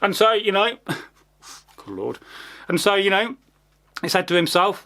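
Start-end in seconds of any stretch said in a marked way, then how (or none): 0.8-2.2 low, soft exasperated sigh; weary sigh. Real, not acted. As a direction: sigh it out, fed up. Weary aside mid-story.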